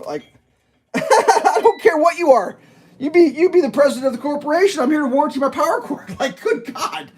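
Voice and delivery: can't hold back a disbelieving laugh. Real, not acted. Customer laughing at the assistant manager's claim.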